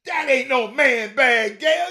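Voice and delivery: loud, high, slow, drawn out; slow, loud, high comic protest; 1 exasperated yell. Real, not acted. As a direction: stretch it out in loud comic disgust. Mock outrage at purse.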